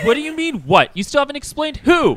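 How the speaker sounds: high, loud, baffled bickering; exasperated squawk. Acted. Confused boss demanding who lost, high exasperated arguing. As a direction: argue in a high indignant voice.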